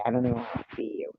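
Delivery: normal talking voice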